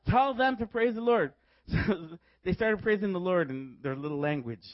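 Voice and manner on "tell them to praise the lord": insistent order. Retold or imitated. Repeats order after hitting the translator.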